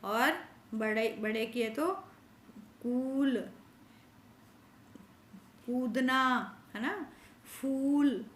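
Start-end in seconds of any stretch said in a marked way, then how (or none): 2.8-8.3 कूल, कूदना, फूल with the ऊ held long and slow; long u drawn out. Shown as a demonstration. Teacher showing children the long ऊ sound. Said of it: "ये बड़ी ऊ की मात्रा के शब्द हैं".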